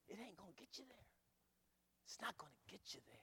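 intense, whispery caution; hushed warning. Real, not acted. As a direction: drop suddenly to a near whisper. Preacher hushes to warn congregation.

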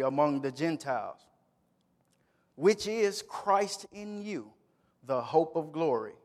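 solemn pulpit reading of scripture. Acted. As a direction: read the passage with solemn care.

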